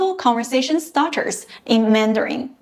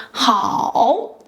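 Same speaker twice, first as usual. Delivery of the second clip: ordinary talk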